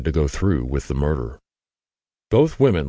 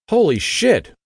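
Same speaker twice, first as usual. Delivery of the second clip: much higher, louder startled outburst; shocked exclamation; 5 dB louder than usual. A narrator's reading. Margaret stunned right after firing the gun, startled shout. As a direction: blurt it out, stunned by the gunshot.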